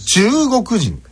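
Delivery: "中国人" rising after 中, high through the middle; high, slow teaching take of "中国人"; exaggerated accent demo. Shown as a demonstration. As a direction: slowly push "中国人" up after the first syllable. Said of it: "アクセントが強くなる".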